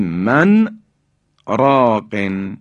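من راقن with a short stop after man; sakt pause. Shown as a demonstration. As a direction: hold the n of من, halt, then راقن. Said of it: "بایستی یک سکتی".

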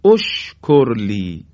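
اشکرلی said slowly, opening with a clear o sound; start with damma. Shown as a demonstration. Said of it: "باید بگیم". (اشکرلی را با ضمه شروع کنید)

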